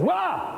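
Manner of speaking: high mock "Wah!" to scare the mice; hammed-up boo of a fright; housewife's scare shout. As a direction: jump out with a cartoon scare cry. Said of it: "like that, from the housewife".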